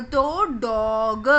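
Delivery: "डॉग" drawn out very slowly down to its last sound. Shown as a demonstration. Teacher to kids, sounding out the last letter. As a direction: stretch "डॉग" so the final ग is heard. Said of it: "last में किसकी आवाज़ आ रही है".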